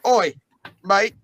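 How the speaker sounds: loud Australian "Oi, mate"; cheeky Australian shout; Aussie accent. Acted. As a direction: call out like an Aussie bloke. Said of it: "Can't do the accent for too long".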